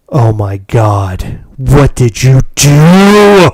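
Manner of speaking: slow, drawn-out loud dismay; mock horror. Imitating Heather finding the broken toy, drawn-out horror. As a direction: draw out the horrified reaction, building to a loud wail. Heather walks in, sees damage.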